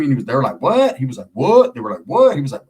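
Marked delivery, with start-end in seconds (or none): 0.6-1.0 high, confrontational retold "what?"; challenging what. Inmates challenging a man who denies being a Wood, confrontational. Acted standoff between two sides. 1.3-1.7 high, louder mock challenge; challenging what. Homie answering the inmates' challenge, confrontational. Acted standoff between two sides.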